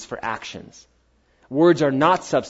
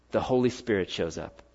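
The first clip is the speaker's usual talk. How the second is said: softer and lower, the answer dropped quietly; quiet reveal; 7 semitones lower, 8 dB quieter than usual. Real, not acted. Preacher answers his own question, softly. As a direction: let the answer fall softly.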